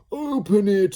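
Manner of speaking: very high, loud squeaky blob voice; Slime's voice. A narrator's reading. Slime urging Ned to open the door, high and loud. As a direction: pipe it up like a gleeful blob. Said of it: "said Slime".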